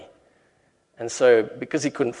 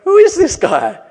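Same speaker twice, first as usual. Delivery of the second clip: loud, high astonished cry; astonished disciples; 12 dB louder than usual. Retold or imitated. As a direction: shout it out in disbelief, much higher. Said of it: "They're like".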